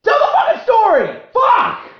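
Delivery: full-volume exasperated shout, very high; angry yell. Acted. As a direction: scream at the podcast host in frustration. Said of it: "me yelling about being angry at Ari Shaffir".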